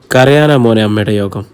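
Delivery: usual speaking voice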